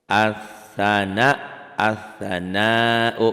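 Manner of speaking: As-sana chanted slowly and loudly, lam silent, s doubled; recited Arabic example. Shown as a demonstration. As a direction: stretch out As-sana like Quran recitation. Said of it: "alif lamnya nggak dibaca". (As-sana dilantunkan pelan, lam tidak dibaca)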